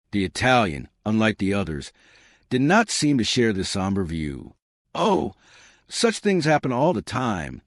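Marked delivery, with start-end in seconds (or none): 4.9-7.6 lightly philosophical, starting high. A narrator's reading. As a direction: wave it off as a fact of life. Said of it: "he said philosophically".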